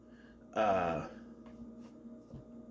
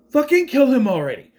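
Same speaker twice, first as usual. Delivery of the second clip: raised, higher exasperated shout; exasperated outburst; 8 semitones higher, 6 dB louder than usual. Retold or imitated. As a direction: yell impatiently at the screen.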